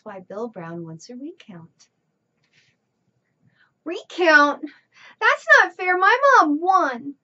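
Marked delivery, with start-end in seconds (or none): 3.8-7.1 loud, high indignant protest. Acted. Child protesting the recount, loud and indignant. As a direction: protest that it's unfair.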